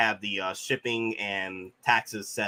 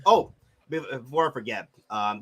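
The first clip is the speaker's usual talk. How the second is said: ordinary talk, nothing marked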